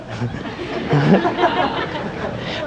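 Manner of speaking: quick amused chuckle; short laugh. Real, not acted. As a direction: laugh it off lightly. Laughs at own joke.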